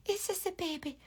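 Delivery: high, hushed coo; soft baby talk. Shown as a demonstration. Cooing to the brush between taps, high and hushed. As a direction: murmur it gently like to a baby. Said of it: "Tap it, tap, tap".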